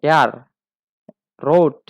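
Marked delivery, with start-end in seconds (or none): 1.4-1.8 রোড spoken loud as German rot; German word demo. Shown as a demonstration. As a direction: model রোড slowly for learners. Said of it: "অর্থ লাল". (German উচ্চারণে রোড)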